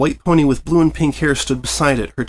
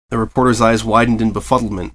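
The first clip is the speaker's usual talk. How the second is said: usual speaking voice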